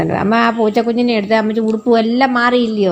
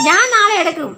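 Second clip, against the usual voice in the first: teasing; 8 semitones higher than usual. Real, not acted. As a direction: tease him, sing-song and high. Mother teasing crying child.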